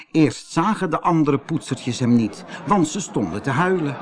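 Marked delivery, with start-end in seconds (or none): none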